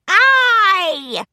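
high, loud crew cheer. Acted. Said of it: "the birds cheered".

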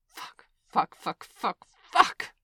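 loud, panicked curses; frantic swearing. Acted. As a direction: swear in mounting alarm.

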